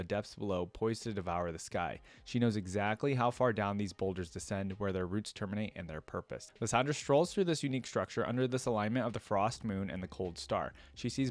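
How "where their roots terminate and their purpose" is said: usual speaking voice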